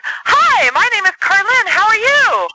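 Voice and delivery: very high, loud chirpy greeting; overly peppy caller. Shown as a demonstration. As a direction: bounce in way too bright on the phone. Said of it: "slow speaking".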